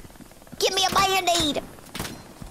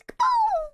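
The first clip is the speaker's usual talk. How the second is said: high cartoon explosion sound; big mock explosion; kaboom explosion noise.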